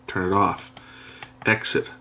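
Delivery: ordinary delivery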